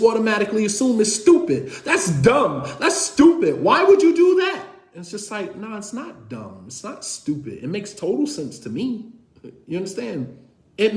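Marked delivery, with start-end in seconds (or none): 1.8-4.6 scornful, rising higher and louder to a sharp peak; mocking wife. Wife scoffing at her husband's reasoning, scornful and shrill. 5.6-9.1 soft protest. Retold or imitated. Husband defending himself to wife.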